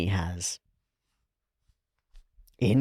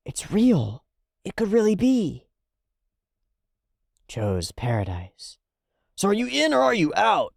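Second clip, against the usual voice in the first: breathless, high and hopeful; awed high voice; excited; 11 semitones higher than usual. Retold or imitated. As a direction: sell the dream, voice lifting high. Excited retelling of Joe's plan.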